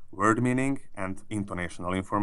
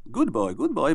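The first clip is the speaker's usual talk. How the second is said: bright, higher praising tone like to a dog; sing-song praise for a good dog; praising intonation; 7 semitones higher than usual. Shown as a demonstration. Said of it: "without the praising intonation".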